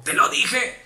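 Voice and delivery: high, scolding I-told-you-so; mock reproach. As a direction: sneer it like a scolding. The reproach not to say.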